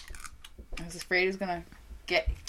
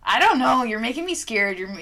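ordinary delivery

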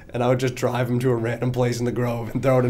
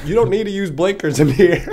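high, louder, laughing through it; laughing mock advice; 8 semitones higher, 7 dB louder than usual. Neighbor advising the new driver, laughing. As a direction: deliver the advice while cracking up.

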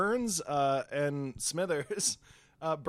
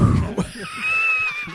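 loud laugh; 7 dB louder than usual. Real, not acted.

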